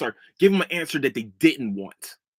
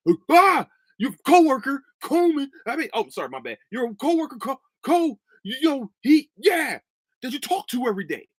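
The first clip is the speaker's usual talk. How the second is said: high, loud whiny stammering mockery; mocking coworker; 10 semitones higher, 3 dB louder than usual. Mocking a coworker who blames him, high and whiny. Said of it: "that's literally what he's doing".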